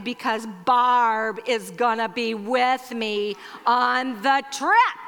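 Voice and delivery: drawn-out, very slow emphasis; deliberate sister imitation. Speaker joking about trip with her sister, exaggeratedly deliberate. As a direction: stretch each word out deliberately. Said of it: "she just talks a little more deliberately".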